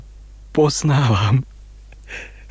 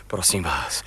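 whispered plea; 6 semitones lower, 8 dB quieter than usual. Acted. Urgent plea to fisherman.

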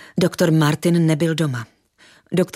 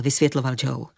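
ordinary delivery

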